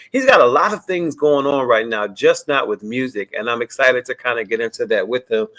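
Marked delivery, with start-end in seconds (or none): none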